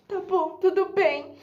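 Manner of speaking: high, hammed-up tearful consent; meek fake crying. Sly pupil accepting the teacher's apology, mock-tearful meekness. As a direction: give in as if still upset. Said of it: "E eu bem sonsa".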